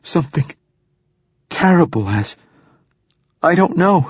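high, breaking sobbing voice. A narrator's reading. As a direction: sob through the words. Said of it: "she managed between sobs".

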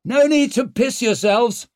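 high, loud teasing call; hearty reassuring shout; jovial reassurance. A narrator's reading. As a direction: holler it to nervous comrades. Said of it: "reassuring laughter in his voice".

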